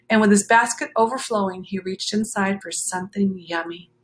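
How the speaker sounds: plain reading voice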